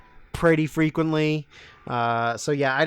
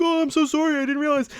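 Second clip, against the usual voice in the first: 1 mock apology; 13 semitones higher, faster than usual. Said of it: "And he's like".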